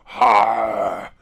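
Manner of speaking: low, very loud beast roar; werebear's pained yell. Acted. Game master as the wounded werebear, low pained yell. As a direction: bellow like a badly hurt werebear. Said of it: "as it yells out".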